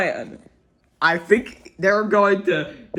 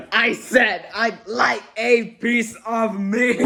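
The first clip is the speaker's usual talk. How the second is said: slow, higher mock roar through laughter; 5 semitones higher, slower than usual. A narrator's reading. Mister Noisy roaring at the butcher who pretends not to hear. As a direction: stretch the roar out, cracking up. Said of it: "roared Mister Noisy".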